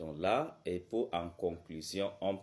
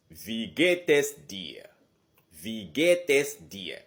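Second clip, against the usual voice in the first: slow, loud Wie geht es dir, tune rising on geht then falling; 2 semitones higher, 7 dB louder, slower than usual. Shown as a demonstration. Teacher shows the tune of wie geht es dir. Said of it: "le ton monte et le ton descend".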